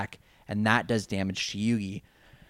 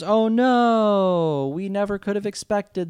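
mock shock; 10 semitones higher, 7 dB louder, slower than usual. Everyone reacts in mock shock.

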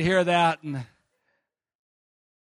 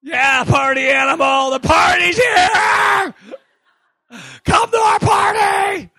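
party shout; 12 semitones higher, 8 dB louder than usual. Acted. Preacher inviting all to the party, loud festive shout. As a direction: throw the doors open to the party. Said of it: "congregation celebration".

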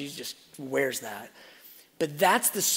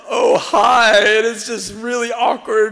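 high, loud hammed-up awkward hi; awkward cry; 8 semitones higher, 12 dB louder, slower than usual. Preacher acting out awkward greeting, loud high hammed-up cry. Awkward meeting retold, cries out.

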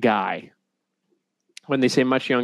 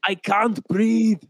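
mock strangled victim; 9 semitones higher, slower than usual. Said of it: "mock victim of leg squeeze".

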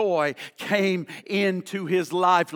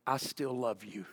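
low, soft, intimate declaration; hushed, loving answer; tender assurance; 8 semitones lower, 12 dB quieter than usual. Retold or imitated. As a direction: drop down gently to reassure. God's gentle answer, voiced.

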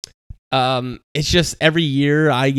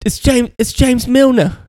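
hammed-up English accent introducing himself; bad English accent; 9 semitones higher, 6 dB louder than usual. Pretending to be Milner meeting fans, bad English accent. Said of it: "That was a shitty accent".

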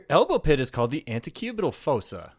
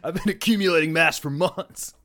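gym bro boasting, slightly higher; frat bro; 4 semitones higher than usual. Pretend. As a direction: puff up like a proud gym bro. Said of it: "they're like".

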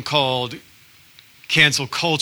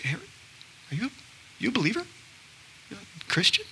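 mock subtle ask; 6 semitones higher, 12 dB quieter, much faster than usual. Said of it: "I was very subtle and calm about it".

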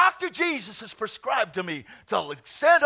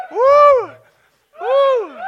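loud, high woo; joyful whoop; 9 dB louder than usual. Real, not acted. Preacher caught up in holy laughter, high joyful whoop. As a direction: whoop with joy.

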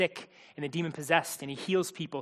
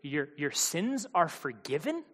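slow, halting echo, rising in doubt; stammered, incredulous question; disbelieving question; 2 semitones lower, 5 dB quieter, slower than usual. Acted. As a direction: repeat it as if you misheard. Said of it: "What did he say?".

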